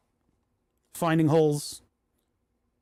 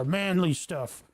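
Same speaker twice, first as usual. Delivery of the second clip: normal talking voice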